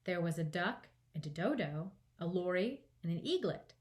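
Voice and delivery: usual speaking voice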